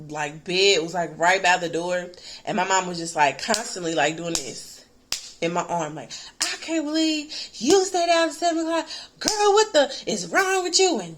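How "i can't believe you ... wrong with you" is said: imitating an angry mother, much higher pitch; mock mom scolding. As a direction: play the furious mom, pitched way up. Mother scolding her in story.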